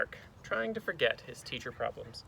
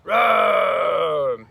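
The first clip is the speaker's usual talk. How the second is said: huge, long, loud roar; booming drawn-out monster roar; bear roar; 4 semitones higher, 14 dB louder than usual. Acted. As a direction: roar like a bear at full force. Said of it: "Miz Kirby still roared".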